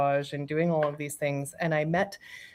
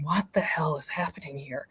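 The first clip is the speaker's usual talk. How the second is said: whisper; 3 semitones lower, 6 dB quieter than usual. Retold or imitated. Retelling panic during meditation.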